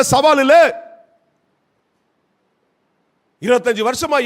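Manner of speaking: loud, high, sustained sermon shout; full-force preaching. Acted. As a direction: preach it to the back of the hall.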